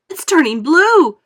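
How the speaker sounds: high, loud, slow horrified scream. A narrator's reading. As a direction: shriek in horror at the change. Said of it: "screamed Missus Beauregard".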